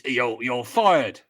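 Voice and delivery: loud boss impression, stumbling into the catchphrase; loud, hammed-up catchphrase delivery; Alan Sugar. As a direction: project it like a TV boss firing someone. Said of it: "Alan Sugar, hang on".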